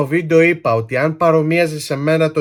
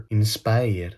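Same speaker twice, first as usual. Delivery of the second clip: put-on INSPIRE lower and softer in a Greek accent; mock Greek accent; 7 semitones lower, 7 dB quieter than usual. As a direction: pronounce "Inspire" with plain Greek sounds. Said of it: "με ελληνική προφορά".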